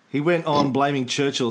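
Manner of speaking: normal talking voice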